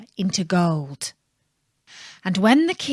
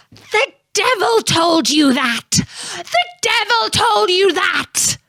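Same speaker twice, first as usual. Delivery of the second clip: shrieking much higher and louder; furious imp scream; 11 semitones higher, 3 dB louder than usual. A narrator's reading. Rumpelstiltskin raging at the queen, high furious cry. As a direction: screech it in a rage. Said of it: "cried the little man".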